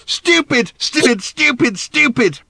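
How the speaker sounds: high, loud repeated shriek; screaming. A narrator's reading. Random screaming at Arthur before running off. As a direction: scream the word at him.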